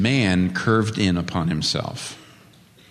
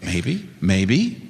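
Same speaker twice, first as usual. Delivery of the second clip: humble admission; 2 semitones lower, much slower than usual. Retold or imitated. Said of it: "our heart should be saying".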